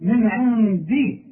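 slow "من عندي" with a firm, clear dal; correct dal. Shown as a demonstration. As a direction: show the crisp dal properly. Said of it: "شوف".

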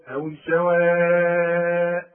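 سواء with the vowel held very long before the hamza; long madd. Shown as a demonstration. Quran teacher demonstrating the connected madd in سواء. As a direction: stretch سواء out long, slow. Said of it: "أمده عند الوقف وعند الوصل".

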